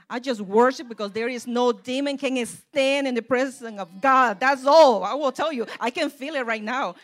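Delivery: loud, rising preacher's shout; full-force preaching. Real, not acted. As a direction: let it ring out with fervor.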